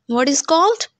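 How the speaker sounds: ordinary talk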